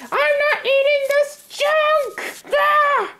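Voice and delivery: high, slow, tearful yelling tantrum; crying shout. Acted. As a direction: scream it like a bratty kid. Child tantrum, throws food.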